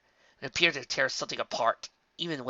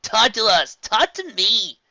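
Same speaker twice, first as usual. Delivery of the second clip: pleading shout; 10 semitones higher, 11 dB louder, slower than usual. Acted.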